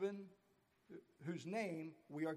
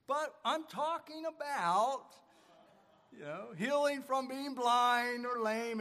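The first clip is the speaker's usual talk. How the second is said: retold reply; 5 semitones higher, 7 dB louder than usual.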